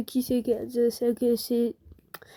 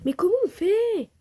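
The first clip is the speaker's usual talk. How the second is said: exasperated disbelief; 8 semitones higher, 4 dB louder than usual. Real, not acted. Baffled by the game.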